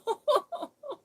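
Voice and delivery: a high squeaky giggle. Real, not acted. After calling herself a goofball, high giggle.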